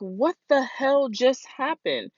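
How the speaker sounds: high, drawn-out cry of disbelief; shocked disbelief. Retold or imitated. Retells own shock at character's death.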